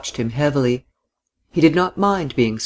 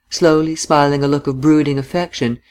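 usual speaking voice

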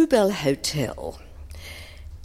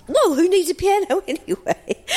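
laughing; 13 semitones higher, 3 dB louder than usual. Real, not acted. Laughing at the drummer's reply.

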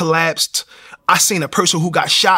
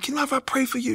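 higher, softer caring nurse; gentle nurse voice; 9 semitones higher, 7 dB quieter, faster than usual. Retold or imitated. Nurse at his hospital bed offering to pray, high and soft. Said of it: "she prayed for me".

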